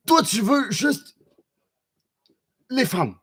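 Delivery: high, slow mocking voice of a horny viewer. Streamer mocking a chat viewer, high and slow. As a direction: stretch it out high to mock him.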